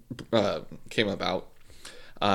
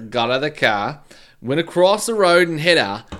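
rough attempt at a Cockney accent; 5 semitones higher, 6 dB louder than usual. Podcaster redoing killer's statement, attempted Cockney accent. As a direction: have a go at Cockney, punching the second half. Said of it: "Do a Cockney one instead".

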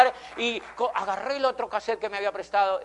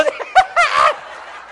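loud airy laugh; 11 dB louder than usual. Real, not acted. Laughs at friend's rejection.

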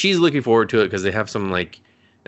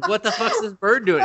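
high-pitched, incredulous outburst; mock bafflement; 11 semitones higher than usual. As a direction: exclaim in comic disbelief. Acts out viewer's baffled reaction.

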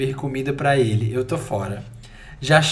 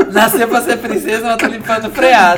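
laughing; 5 semitones higher, 6 dB louder than usual. Real, not acted. Laughing at Catherine's fate.

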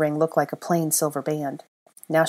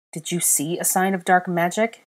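ordinary delivery

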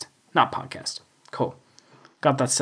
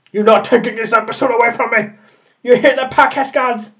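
fast, raised challenge; mock defiance; 6 semitones higher, 4 dB louder than usual. Daring the podcast gods, fast mock defiance. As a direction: shout up at the gods.

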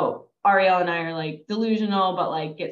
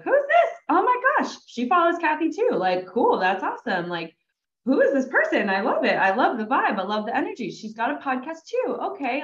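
excited; 8 semitones higher than usual. Retold or imitated. Excited inner voice on seeing message.

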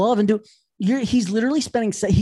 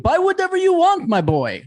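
imitating a big-spending dad, high and lifted; grand mock-generous dad voice, pitched up; magnanimous dad; 11 semitones higher, 3 dB louder than usual. Dad telling his kid to shop freely, mock magnanimous. Father indulging kid in store.

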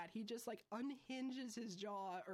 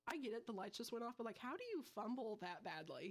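ordinary delivery

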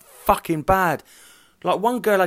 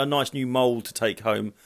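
ordinary delivery

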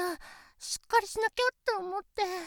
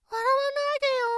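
louder, higher pouty scolding; embarrassed protest; 5 semitones higher, 6 dB louder than usual. Acted. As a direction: stop him laughing, flustered. Embarrassed at being laughed at.

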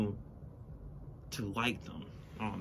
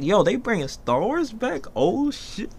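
hype reaction; 9 semitones higher, slower than usual. Retold or imitated. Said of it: "I was like".

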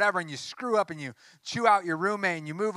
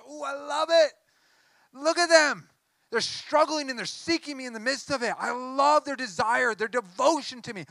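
higher, overjoyed voice of God; delighted God; 8 semitones higher, 3 dB louder than usual. Acted. God's heart delighting over people who struggle and seek, high and overjoyed. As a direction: let God's heart gush with joy, pitched up. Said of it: "his heart just goes".

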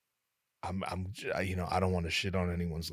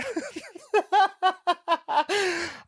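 loud, high-pitched cackle; 5 dB louder than usual. Retold or imitated. Jolly clerk laughing at her customer, high cackle.

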